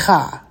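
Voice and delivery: "cha" with a throaty kh, as in Bach; pronunciation demo. Shown as a demonstration. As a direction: scrape the start of "cha" at the back of the throat. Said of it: "the second syllable is pronounced".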